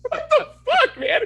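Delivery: very high, loud incredulous cry; squeaky-high retold disbelief; exasperated outburst. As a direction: protest in laughing outrage. Acting own exasperated reaction.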